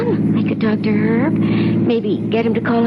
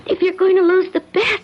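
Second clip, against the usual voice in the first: high, tearful pleading; frightened pleading; 6 semitones higher than usual. Acted. Sherry begs Mort about the lost bet, tearful and high. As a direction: beg him, near tears.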